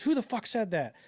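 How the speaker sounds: high mock sergeant's demand; Hartman. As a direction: play the drill sergeant challenging the ranks. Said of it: "And he goes".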